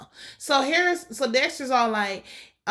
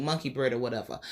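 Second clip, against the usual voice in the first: ordinary talk, nothing marked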